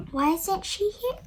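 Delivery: plain reading voice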